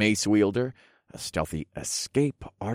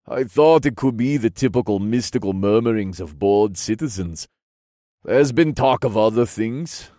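stately, formal royal voice; regal king, sceptical; 5 dB louder than usual. Acted. King discussing rumours of monsters, stately sceptical voice. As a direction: discount the rumours with kingly assurance.

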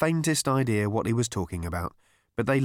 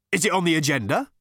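snappish interruption, pitched up; mild snap; 6 semitones higher, 4 dB louder, faster than usual. A narrator's reading. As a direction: cut in with a touch of irritation. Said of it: "snapped the man".

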